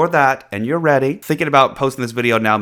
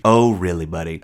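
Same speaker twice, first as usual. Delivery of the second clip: lower, mock-tough tease; teasing challenge; 4 semitones lower, 4 dB louder than usual. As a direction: dare him playfully. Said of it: "repeated taunt".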